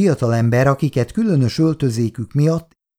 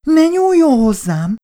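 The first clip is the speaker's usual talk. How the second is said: high, loud acted shriek; screamed; 6 dB louder than usual. A narrator's reading. Girl grabbed by the arm by a thug, screaming. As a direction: shriek it to fend him off. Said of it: "sikoltott Icu".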